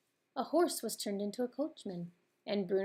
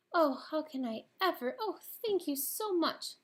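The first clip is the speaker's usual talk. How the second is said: higher, breathless overjoyed thanks; delighted cry; 9 semitones higher, 4 dB louder than usual. A narrator's reading. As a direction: gush with grateful joy. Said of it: "the delighted girl cried".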